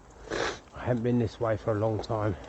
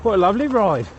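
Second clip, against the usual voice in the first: calling out; 8 semitones higher, 8 dB louder than usual. Real, not acted. Greeting walker while riding past.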